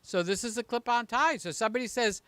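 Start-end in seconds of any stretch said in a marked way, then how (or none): none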